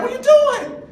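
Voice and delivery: a dad horrified at what he just heard; shocked outburst. Retold or imitated. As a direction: blurt it out in disbelief. Shocked dad confronting toilet habit.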